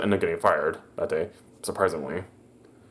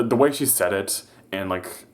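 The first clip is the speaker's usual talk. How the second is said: ordinary talk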